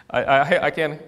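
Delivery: breaking up with laughter while preaching; real laughing. Real, not acted. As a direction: try to talk through the laughs. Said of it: "I'm sorry for laughing".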